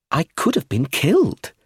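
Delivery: pleased with her own narrow escape; pleased child. A narrator's reading. Child touches bandaged head, sounding pleased. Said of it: "sounding pleased".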